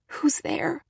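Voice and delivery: terrified breathless whisper; voiceless whisper. Acted. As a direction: try to call out but only air comes. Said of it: "I tried to call out those words, but no sound escaped".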